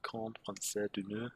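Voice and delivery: slow, badly faked French accent. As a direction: make a clumsy, drawn-out try at French. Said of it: "very bad try at French".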